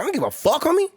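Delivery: defiant. Retold or imitated. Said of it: "so I was like".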